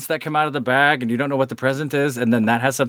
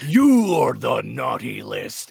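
slow menacing Santa voice; 4 semitones lower, much slower than usual. Pretend. Muscle Santa catchphrase.

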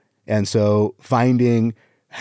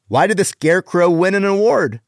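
higher, hammed-up riddle; exaggerated joke setup; 7 semitones higher than usual. Shown as a demonstration. Comic retrying a one-liner, exaggerated setup. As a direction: pose the riddle up high, with showmanship. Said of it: "on the delivery".

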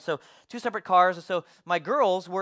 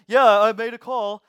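louder, dismissive mock reply; throwing attitude; 3 semitones higher, 9 dB louder than usual. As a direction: imitate a worker brushing off a worried dad. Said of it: "He was throwing attitude at me".

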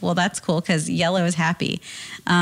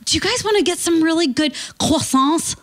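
high-pitched imitation French accent; thick French accent; 9 semitones higher than usual. Imitating Ryan's mom offering croissants, thick French accent. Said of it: "she has the thickest French accent".

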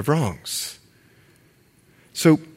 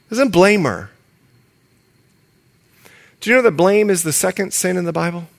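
normal talking voice

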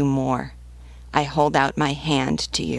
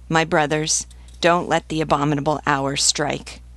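ordinary delivery, nothing marked

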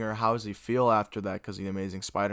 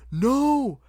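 high, loud imitated hero's cry; desperate cry; 7 dB louder than usual. Spider-Man seeing MJ fall, desperate high cry. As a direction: shout it as she falls, voice shooting up. Voicing Andrew as MJ falls.

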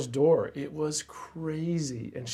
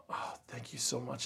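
much softer, a touch of retold crying; a quiet, grateful voice through tears; teary thanks; 11 dB quieter than usual. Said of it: "and she's crying".

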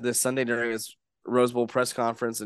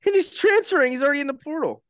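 excited; 5 dB louder, faster than usual. Real, not acted. Same words repeated, higher.

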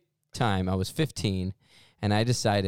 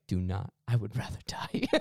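urgent hushed whisper, pleading; whispered plea. Retold or imitated. Pleading with his friend in a hushed whisper. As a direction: whisper it so nobody hears. Begging friend not to tell.

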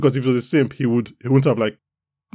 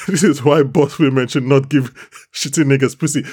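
ordinary talk, nothing marked

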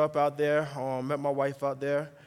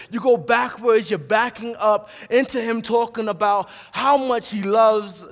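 loud, raised, driving sermon delivery; full-force preaching; 5 semitones higher, 9 dB louder than usual. Acted. Preacher telling the church how the psalmist loves God's law, full-force preaching. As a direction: preach it forcefully, punching the key words.

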